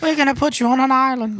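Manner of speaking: high-pitched mock British royal; the queen. Queen banishing prisoners, high mock-regal voice. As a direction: put on a high, far-from-natural queen voice. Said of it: "the queen was like".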